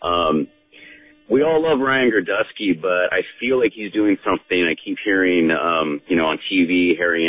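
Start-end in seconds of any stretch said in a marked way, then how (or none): none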